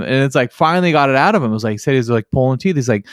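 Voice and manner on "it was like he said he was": ordinary talk, nothing marked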